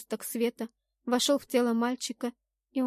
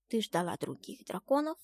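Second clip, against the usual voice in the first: hurt little dragon's question; quietly miffed; offended; 4 dB quieter than usual. A narrator's reading. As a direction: ask as if slighted. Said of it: "обиженный голос Шторма".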